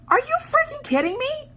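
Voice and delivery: shocked disbelief. Retold or imitated. Husband learning what Harbor Freight sells, high shocked squeal. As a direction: squeal it like you can't believe it.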